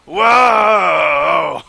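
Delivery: surprised shout. Real, not acted. Shouting at the clip.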